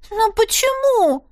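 put-on high, slow little girl's plea; little girl voiced high, pleading; child asking. A narrator's reading. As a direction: whine it like a small confused girl. Little girl asks mother.

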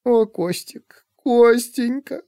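an aunt weeping as her nephew is taken away; tearful. A narrator's reading. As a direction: wail his name through tears. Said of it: "снова заплакала".

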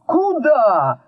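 high, sharp call of outrage; angry. A narrator's reading. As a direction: cry out crossly at the donkey. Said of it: "зло ударил его Кощей пятками".

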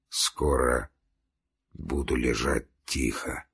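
low, very soft murmur; weak dying voice. A narrator's reading. Dying man answers Marya, weak and low. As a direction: speak faintly, as if nearly spent.

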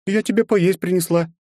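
a hint of a brisk older woman; woman's voice. Marya Ivanovna speaks, female voice.

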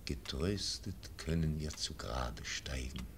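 normal talking voice

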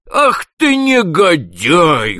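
high, loud, very slow cry of contempt; an enraged boss cursing his clerk; furious shout. A narrator's reading. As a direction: spit out the insult slowly. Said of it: "выкрикнул он".

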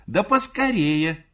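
loud, high, drawn-out hurry-up call; крик матери. A narrator's reading. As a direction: shout impatiently, stretching the words. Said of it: "кричала мать". (нетерпеливо кричит, высоко и протяжно)

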